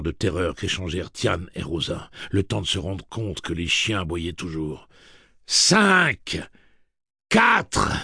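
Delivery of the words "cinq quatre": loud, high single numbers; raised mechanical count; robot countdown. A narrator's reading. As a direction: call each number like a machine.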